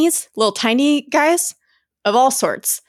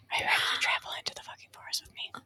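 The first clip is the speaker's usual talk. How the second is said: hushed, hurried conspiratorial whisper; playful whisper; 15 dB quieter, much faster than usual. Pretend. Host invites co-host into the forest, playful whisper. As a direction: whisper it fast, barely audible.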